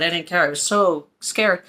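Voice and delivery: usual speaking voice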